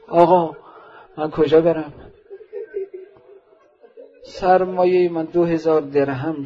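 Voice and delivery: a desperate loud plea to his master; loud pleading. Acted. Nafi at Imam Hussein's feet, begging loudly to stay.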